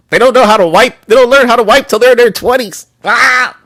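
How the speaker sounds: worked-up rant. Real, not acted. Streamer ranting about dating dirty women.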